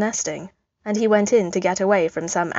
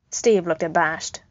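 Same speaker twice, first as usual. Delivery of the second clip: usual speaking voice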